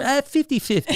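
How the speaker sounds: high, carefree put-on voice; breezy fixer voice. Fixer shrugs off the danger to Jonah, high and breezy. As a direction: toss it off high and casual. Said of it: "'cause you go".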